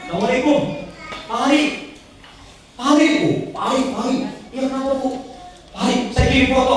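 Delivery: high, eager voice of a woman calling; eager caller. Retold or imitated. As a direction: call out his name urgently on the phone. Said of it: "kata dia". (suara ibu penelepon yang bersemangat dan bernada tinggi)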